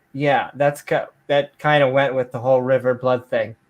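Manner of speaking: ordinary talk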